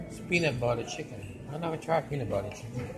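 ordinary delivery

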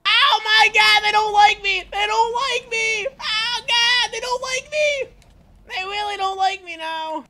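high, loud crying shout; hammed-up panicked wail. Player attacked in game, hammed-up panicked wail. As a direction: wail it over and over.

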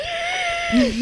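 very high squealed imitation; stomach noise. Storyteller imitating her drunk stomach, high squeal. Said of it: "my stomach's, like".

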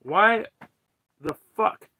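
loud, exasperated demand, broken by pauses; heated. Real, not acted. Streamer asking why we act without knowing, heated. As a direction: punch each why with real frustration. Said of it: "I'm fucking heated today".

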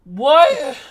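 very high, loud incredulous yelp; cracked, squeaky shout of disbelief; voice-cracking shout. Retold or imitated. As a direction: shriek it in disbelief till the voice cracks. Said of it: "that crack hurt".

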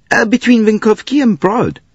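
a high, chatty conductor; high character voice. Acted. Conductor recalling being snowed up, high voiced. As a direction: raise the voice for the conductor.

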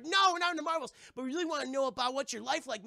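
put-on high-pitched pestering kids; eager boys. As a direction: pipe up eagerly at the uncle. Eager boys pester their uncle.

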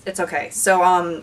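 usual speaking voice

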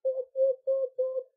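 high, loud double whoop; celebratory whoops. Real, not acted. Vlogger celebrating her new iPhone, loud high whoops.

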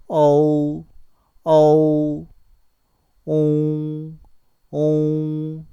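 어우 and 옹 loud, long and level; read-along model. Shown as a demonstration. Teacher leads reading of 어우 and 옹, loud and sustained. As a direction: hold each 어우 and 옹 for learners to copy. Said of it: "한번 읽어보시죠".

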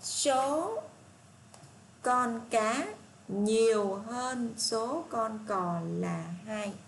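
very slow, deliberate reading of the statement; slow read-aloud. Shown as a demonstration. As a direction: stretch each word so the class can follow. Reads statement C slowly. (đọc chậm từng chữ câu C)

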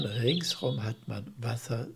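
ordinary delivery

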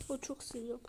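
ordinary talk

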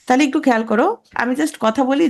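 ordinary talk, nothing marked

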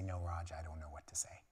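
soft whispered mock reply; hushed pretend answer; mock stumped whisper. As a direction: whisper it like someone caught out. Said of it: "anybody in this place to say".

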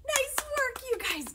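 high, loud cheer; excited praise. Real, not acted. Leader praising children after the wombat song, excited cheer.

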